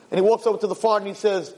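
usual speaking voice

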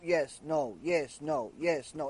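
low, soft, monotonous recital; boring lecturer. Retold or imitated. Speaker mimicking a boring shiur, droning low and soft. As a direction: drone it out like a dull speaker. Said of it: "It's a boring shiur".

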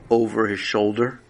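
ordinary delivery

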